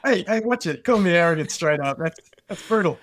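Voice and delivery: mock offence. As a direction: pretend to be wounded by the teasing. Jokingly protests being called arrogant.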